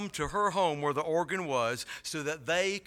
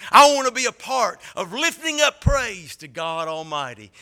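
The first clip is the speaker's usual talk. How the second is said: louder, higher preaching build; passionate preaching; 5 semitones higher, 10 dB louder than usual. Acted. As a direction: lift it up like a sermon.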